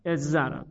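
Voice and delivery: اضطرب said as ezzarab, z doubled; merged form. Shown as a demonstration. Teacher showing students the merged form, doubled z.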